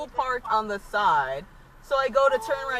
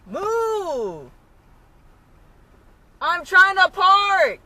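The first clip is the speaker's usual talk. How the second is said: furious yelling at a driver; berserk rage; 7 semitones higher, 11 dB louder, much slower than usual. Real, not acted. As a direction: yell it out in real rage. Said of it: "stop yelling".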